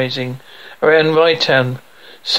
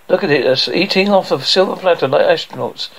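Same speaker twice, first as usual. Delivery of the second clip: ordinary delivery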